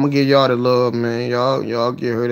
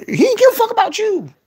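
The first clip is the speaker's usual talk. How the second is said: high, loud worked-up cry; excited; 4 dB louder than usual. Real, not acted. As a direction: peak of the build, almost squeal. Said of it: "I get excited, start breathing heavy".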